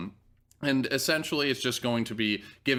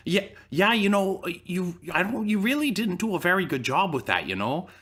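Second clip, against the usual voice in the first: put-on higher, polite Minnesota accent; mock Ray scolding in a polite Minnesota accent; imitating Ray; 7 semitones higher than usual. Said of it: "he's like".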